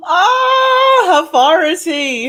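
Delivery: high, loud excited cry; thrilled, slow gushing question. Real, not acted. Gasps at news of game.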